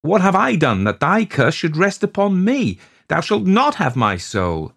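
high, loud, impassioned protest; desperate, high-pitched refusal; defiant cry. A narrator's reading. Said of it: "I cried".

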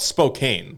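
Spokane as spo-CANE, with a long a; spelling pronunciation. Shown as a demonstration. Host defending the misreading, Spokane as spo-CANE. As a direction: read Spokane as spelt, rhyming with cane. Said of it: "It's spelt Spokane".

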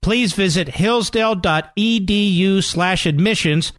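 slow, clear ad announcer read. Acted. Ad announcer giving the college web address slowly.

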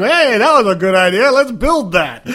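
loud, high, cheerful naive voice; clueless scientist voice. Pretend. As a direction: play the oblivious inventor, all chirpy. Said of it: "They're going".

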